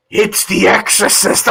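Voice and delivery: slow, creepy put-on voice; imitating a dad trying to scare. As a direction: draw it out eerily to spook someone. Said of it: "he comes in really creepy".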